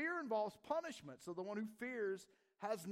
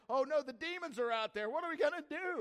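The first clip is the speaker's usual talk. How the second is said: louder, higher cry of alarm; mock panic; 7 semitones higher, 7 dB louder than usual. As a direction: act out someone panicking about demons. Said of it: "This fear is like".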